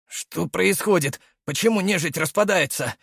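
hoarse, urgent question. A narrator's reading. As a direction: ask it rasping and worn out. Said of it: "хриплым голосом спросил". (хриплый голос)

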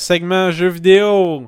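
slow, loud announcer call. Acted. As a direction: announce the segment like a host. Announcing the game segment.

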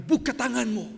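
loud, high preached command; forceful command. Retold or imitated. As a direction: call it out as an order. (perintah lantang bernada tinggi)

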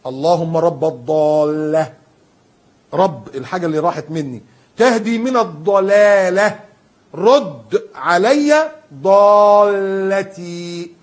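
slow, drawn-out prayerful plea; supplication. Shown as a demonstration. Preacher teaching the prayer for a lost item, slow supplication. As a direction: stretch each word like a humble prayer. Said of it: "أدي حديث".

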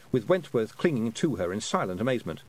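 ordinary delivery, nothing marked

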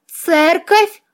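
high, rising squeak of dismay; doomed squeak. A narrator's reading. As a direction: squeak it like a doomed little vampire. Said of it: "обречённо пискнул посеревший вампир".

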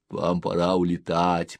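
ordinary delivery, nothing marked

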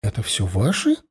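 mildly taken aback, voice lifting at the end; somewhat surprised. A narrator's reading. Blonde looks at the many children, mildly surprised. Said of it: "несколько удивлённо спросила".

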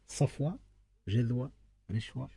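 ordinary delivery

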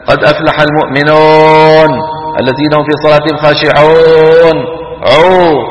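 long "oh" endings, المؤمنون as "mu'minohn", خاشعون as "khashi'ohn"; waw mixed with alif. Retold or imitated. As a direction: imitate a faulty recitation, drawing و in المؤمنون and خاشعون into an open oh. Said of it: "خلط صوتها بشيء من صوت الألف". (تلاوة بواو ممالة نحو الألف في المؤمنون وخاشعون)

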